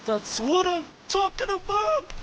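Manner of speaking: high, elated cheer; excited. Real, not acted. Fish caught, celebrating.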